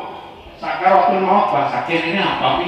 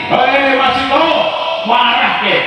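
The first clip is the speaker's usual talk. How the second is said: higher, louder angry king's voice; angry Pharaoh; 8 semitones higher, 4 dB louder than usual. Acted. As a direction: bark at him like a furious tyrant. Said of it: "Fir'aun ini marah".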